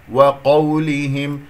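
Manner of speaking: وقولهم with the و drawn out long and light; stretched waw. Shown as a demonstration. Teacher lengthening the و so students notice it. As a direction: slowly lengthen the و at the start of وقولهم. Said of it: "أنا طولت صوت الواو قليلًا".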